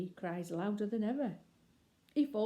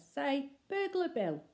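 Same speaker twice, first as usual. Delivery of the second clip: much higher, slow prompting; coaxing a baby; 7 semitones higher, slower than usual. Acted. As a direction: coax the baby to repeat it. Bill coaching baby to speak.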